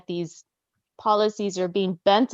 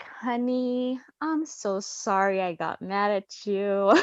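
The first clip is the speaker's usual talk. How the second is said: high, sugary apologetic voice; mock sweet honey voice; sweet apology; 4 semitones higher than usual. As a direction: coo a sorry to your husband. Apologizing sweetly to husband in retelling.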